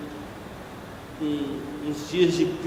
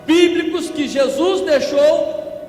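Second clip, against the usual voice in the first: higher, louder preacher's cry building to a shout; preaching at full force; 7 semitones higher, 5 dB louder than usual. Acted. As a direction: rail against the church drifting away.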